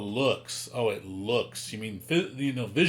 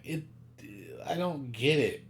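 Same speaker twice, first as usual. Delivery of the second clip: ordinary talk, nothing marked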